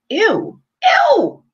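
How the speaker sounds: loud, high-pitched ew of disgust; revolted squeal. Real, not acted. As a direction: recoil in disgust, voice jumping up. Repulsed by the video she describes.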